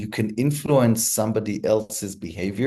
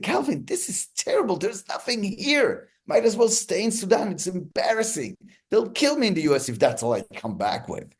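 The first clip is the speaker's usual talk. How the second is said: retold angry yelling; 10 semitones higher than usual. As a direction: act out an upset boss berating his aide. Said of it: "he's yelling at him".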